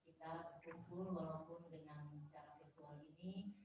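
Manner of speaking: faint voice, too far from the mic; speaking too softly to be heard; barely audible. Real, not acted. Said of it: "Suaranya terlalu kecil".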